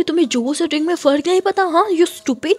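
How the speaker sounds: high, loud scolding; harsh scolding. Acted. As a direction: tear into her. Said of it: "उसे बहुत ज़्यादा डांट देती है".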